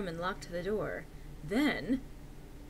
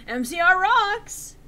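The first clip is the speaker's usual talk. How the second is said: excited cheer; 11 semitones higher, 11 dB louder, slower than usual. Acted. Cheering band name.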